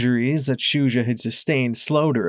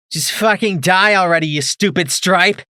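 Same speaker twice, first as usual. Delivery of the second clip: loud, higher furious scream; 8 semitones higher, 7 dB louder than usual. A narrator's reading. Mare firing at Shuja, screaming in rage. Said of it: "she screamed".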